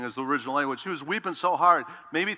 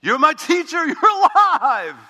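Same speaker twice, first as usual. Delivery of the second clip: high, loud joyful shout of recognition; overjoyed cry; 14 semitones higher, 13 dB louder, slower than usual. Acted. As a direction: burst out in sudden joy. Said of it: "she lit up and goes".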